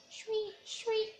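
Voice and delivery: high thin screech of a bird; screeching bird call. Acted. Narrator voicing the weaver bird's cry for children, high screech. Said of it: "heard a screeching sound".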